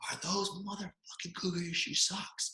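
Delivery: high, slow yell held down in volume; imitated coach's yell. Coach walking toward player about socks, muted mock yell. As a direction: call it out high and stretched, at reduced volume. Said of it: "And he's yelling".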